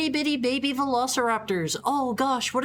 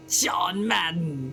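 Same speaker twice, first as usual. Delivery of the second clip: announcer voice; 3 semitones lower, much slower than usual. Pretend. Announcer-style name call.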